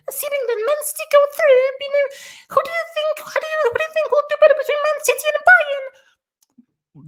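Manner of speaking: very high falsetto woman impression; badly faked female voice, squeaky high; imitating female presenter. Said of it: "I can't do a female accent".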